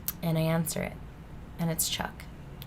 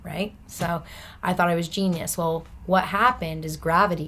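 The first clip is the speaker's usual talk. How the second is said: ordinary delivery, nothing marked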